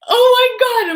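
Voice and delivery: high, loud cry of panic; panicked cry. Retold or imitated. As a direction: cry out as everything is gone. Discovering everything is lost.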